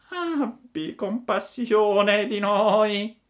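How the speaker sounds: high, slow begging whine of a sly fox; whining fox. A narrator's reading. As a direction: beg pitifully, drawing out every word. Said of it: "con voce di piagnisteo".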